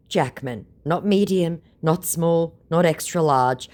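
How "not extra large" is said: ordinary talk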